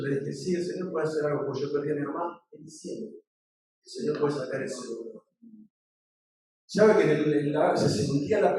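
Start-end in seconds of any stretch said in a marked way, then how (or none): 0.0-5.2 low, soft, consoling tone; tender retold reassurance; gentle comforting. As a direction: speak quietly to someone grieving. Comforting a grieving young man.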